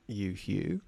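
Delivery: ordinary talk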